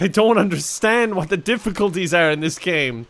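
ordinary delivery

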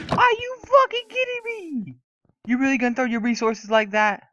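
very high, loud screaming disbelief; outraged screech. Real, not acted. Gamer whose resources got wasted, outraged screech. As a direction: screech it at whoever wasted your stuff.

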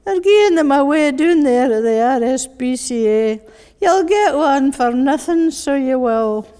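put-on pleading child in Glasgow accent; strong Glasgow accent. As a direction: wheedle Daddy in a broad Glaswegian voice. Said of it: "that strong Glasgow voice".

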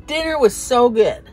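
normal talking voice

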